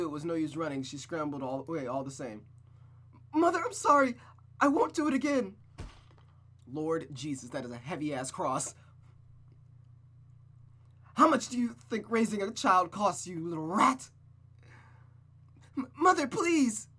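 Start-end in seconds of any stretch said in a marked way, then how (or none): 3.3-5.6 very high, loud, desperate cry; terrified begging. Acted. Rosa begging her mother to stop hitting her, terrified. Rose begs while being beaten. 11.1-14.1 loud, higher, contemptuous rebuke; cruel scolding. Acted. Mother berating Rosa as she beats her, loud and cruel. 15.8-16.9 very high, loud, desperate scream; screaming plea. Acted. Rosa screaming at her mother to stop, desperate.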